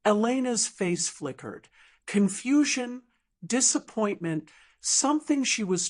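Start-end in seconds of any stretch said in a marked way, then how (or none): none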